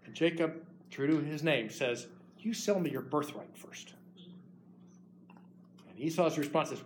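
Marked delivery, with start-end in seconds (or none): 2.4-3.9 scheming whisper. Acted. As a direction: whisper it like a cunning deal. Scheming Jacob bargains.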